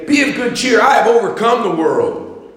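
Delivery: high, loud preacher's cry; full-force preaching. Acted. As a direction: preach it to the back row.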